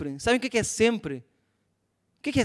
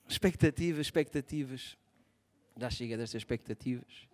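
mock solemn murmur; 9 semitones lower, 8 dB quieter than usual. Mock dramatic segue.

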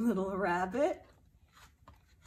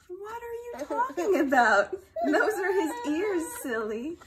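louder, higher mock outrage; indignant retort; 6 semitones higher, 8 dB louder than usual. Parent voicing rabbit side to child, mock indignant. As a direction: argue back, playfully offended. Duck-rabbit argument voices.

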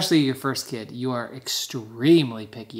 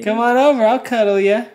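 high, louder coaxing call; pet talk; 8 semitones higher, 7 dB louder than usual. Real, not acted. Husband calls the jealous dog over, high coaxing.

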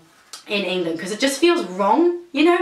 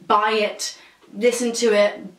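usual speaking voice

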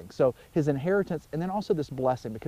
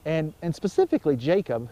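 ordinary talk

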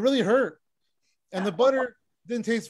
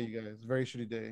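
sad low mumble; 9 semitones lower, 11 dB quieter than usual. Pretend. Deadpan self-pity.

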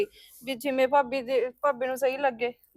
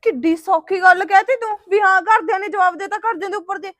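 higher, much louder, fast furious tirade; furious shouting; 7 semitones higher, 13 dB louder than usual. Acted. Girlfriend yelling at boyfriend about his broken promises. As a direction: hurl his broken promises back at him.

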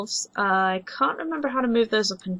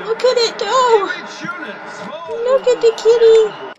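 higher, louder, laughing squeal; delighted squeal; 11 semitones higher, 4 dB louder than usual. Real, not acted. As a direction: cheer the kitty on. Cheering kitten on screen.

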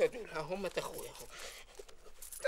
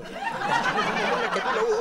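much higher squealing offer; comic squeal; 4 dB louder than usual. Acted. Employee offering to buy his boss's morals, in a high squeal.

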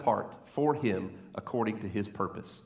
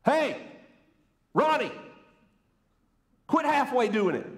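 high, raised-voice mock command from heaven; God calling down; 10 semitones higher than usual. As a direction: call down sharply from the skies, pausing after each address. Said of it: "I wish God would just, from the heavens".

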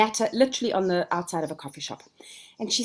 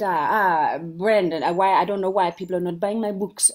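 badly imitated foreign accent, a bit louder than usual; bad Paulo Coelho accent; 4 dB louder than usual. Author complaining to his marketer, in a bad put-on accent. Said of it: "Oh, that's a bad accent".

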